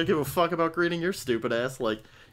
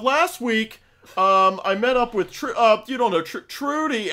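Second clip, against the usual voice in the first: high, loud chatty customer voice; rambling customer; 10 semitones higher, 7 dB louder, slower than usual. Retold or imitated. Mimicking a customer rambling about Trudy at the checkout. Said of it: "they're just fucking going".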